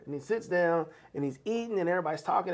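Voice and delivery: ordinary delivery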